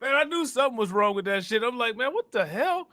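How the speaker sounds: high, laughing "what the hell"; amused disbelief. Real, not acted. Streamer realising the sheriff's feed froze, laughing disbelief. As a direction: squeal it out through a laugh.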